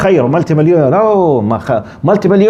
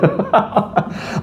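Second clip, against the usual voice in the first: soft quiet chuckle; 4 semitones higher, 7 dB quieter than usual. Real, not acted. Laughs at guilty man.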